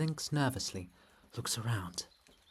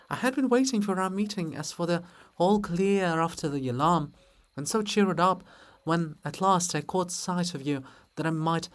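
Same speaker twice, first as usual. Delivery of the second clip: higher and louder, pleading to be understood; eager apology; 7 semitones higher, 7 dB louder than usual. Acted. Libet hurrying to apologise to Olha, high and eager. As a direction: rush to make amends.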